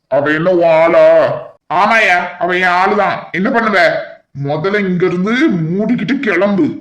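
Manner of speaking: ordinary talk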